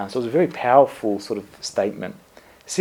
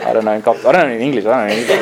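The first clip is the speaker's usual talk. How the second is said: laughing protest; 7 dB louder, much faster than usual. Real, not acted. Retelling his panic, laughing.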